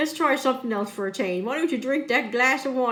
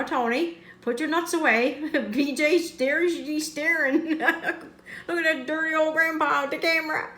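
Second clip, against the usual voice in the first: ordinary talk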